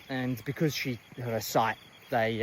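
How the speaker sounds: ordinary delivery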